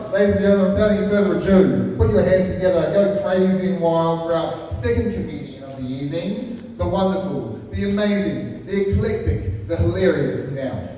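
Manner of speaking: loud, building MC hype introduction; announcer intro. Acted. MC hyping the crowd for the comedian, loud announcer voice. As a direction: whip the crowd up for the next act.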